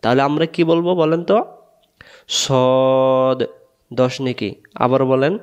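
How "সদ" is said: "সদ" with one long rounded "o"; rounded-mouth pronunciation. Shown as a demonstration. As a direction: round the mouth and hold "সদ" as a drawn-out "sawd". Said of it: "মুখটা গোল হবে". ("সদ" মুখ গোল করে লম্বা টেনে)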